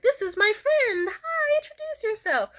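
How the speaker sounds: high, drawn-out social chirp; perky introduction. Acted. Covering for a forgotten name, high and perky. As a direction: introduce a friend in a high, put-on sweet voice.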